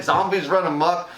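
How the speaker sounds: usual speaking voice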